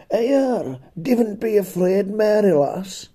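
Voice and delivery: high slow broad Cumbrian angel; slow, high Cumbrian drawl; broad Cumbrian accent. Pretend. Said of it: "It was in broad Cumbrian".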